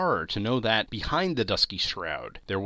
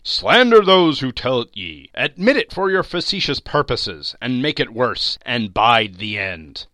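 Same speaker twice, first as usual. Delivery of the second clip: loud, stern spirit voice; echoing ethereal energy being; 9 dB louder than usual. A narrator's reading. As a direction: thunder the warning like a spirit. Spirit's angry warning continues.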